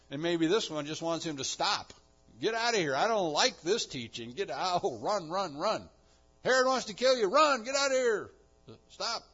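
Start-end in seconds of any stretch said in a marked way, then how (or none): 2.4-9.2 high, loud panicked pleading; frantic urging. Acted. Bystanders pressing Jesus to flee Herod, loud frantic urging. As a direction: hurry him away in alarm.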